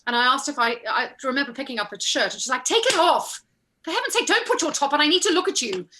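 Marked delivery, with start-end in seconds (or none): 2.6-5.9 high, exasperated mock command; impatient order. Costumier stopping a cold actor covering up, impatient. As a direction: imitate a costumier snapping out orders.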